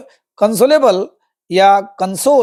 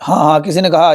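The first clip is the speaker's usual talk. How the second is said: normal talking voice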